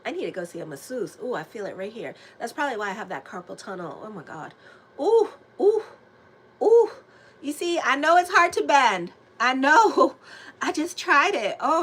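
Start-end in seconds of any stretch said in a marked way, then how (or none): 5.0-6.9 high, louder wincing ooh; pained ooh. Real, not acted. Wincing out loud while stretching her stiff neck. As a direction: cry out at the neck cracking.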